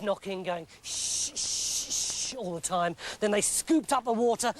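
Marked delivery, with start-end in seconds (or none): none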